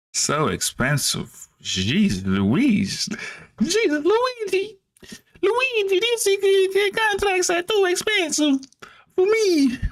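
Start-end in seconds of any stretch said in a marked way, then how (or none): 3.6-9.8 hammed-up Italian accent, very high; overdone fake Italian voice, pitched way up; bad Italian accent. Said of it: "worst Italian accent imitation ever".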